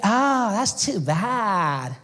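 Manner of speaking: put-on high, slow airy California girl; valley girl voice. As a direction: play the ditzy Malibu surfer girl. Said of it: "She's like".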